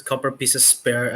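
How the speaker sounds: usual speaking voice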